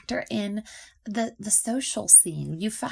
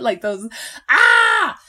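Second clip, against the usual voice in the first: exclaim; 6 semitones higher, 11 dB louder, much slower than usual. Real, not acted. Exasperated cry.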